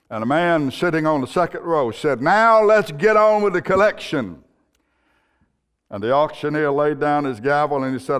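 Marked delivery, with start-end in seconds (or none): 2.2-4.4 high, insistent call from the crowd; impatient demand. Acted. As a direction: demand it over the heads of the room. Impatient man in second row.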